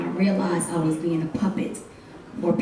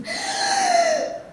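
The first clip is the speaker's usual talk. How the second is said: sharp high gasp for air; a dramatic, staged gasp; loud gasp. Acted. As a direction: gasp loudly as if drowning. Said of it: "I mean, we gasp".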